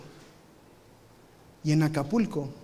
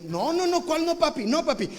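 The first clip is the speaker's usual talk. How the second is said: put-on pleading kids; 11 semitones higher than usual. Kids begging dad.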